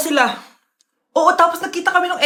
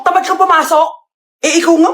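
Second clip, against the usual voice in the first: normal talking voice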